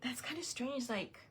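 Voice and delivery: hushed whispered doubt; whispered aside to yourself. Retold or imitated. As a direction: breathe it under your breath, puzzled. Whispered self-talk about mouse.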